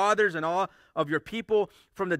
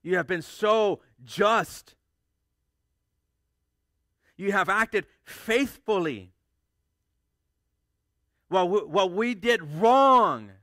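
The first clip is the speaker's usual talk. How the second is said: reverent confession to God; 3 semitones higher, 6 dB louder, slower than usual. Retold or imitated. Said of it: "They're like".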